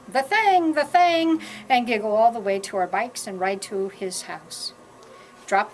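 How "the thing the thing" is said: high, loud gleeful yell; kids yelling. A narrator's reading. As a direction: shout it out like excited kids running downhill. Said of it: "we yell".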